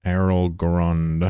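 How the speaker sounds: Errol Grund stretched very slowly, each syllable savored; drawn-out name. Shown as a demonstration. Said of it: "Jeez, I hate that".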